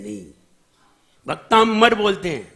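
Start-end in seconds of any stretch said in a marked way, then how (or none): 1.3-1.9 भक्तामर with a heavy doubled m, "bak-TAAMM-ar"; भक्तामर run together as "baktammar"; mispronounced. Shown as a demonstration. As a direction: lean on the middle and hold the m of भक्तामर.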